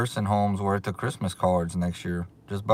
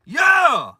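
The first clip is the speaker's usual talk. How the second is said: high, loud yell of joy; real victory yeah; excited shout; 8 dB louder than usual. Real, not acted. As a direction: celebrate out loud. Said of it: "I got so excited".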